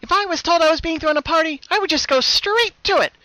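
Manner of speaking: a bubbly voice losing patience; high, loud sing-song villain Pinkie; impatient other Pinkie. A narrator's reading. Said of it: "her patience was wearing thin".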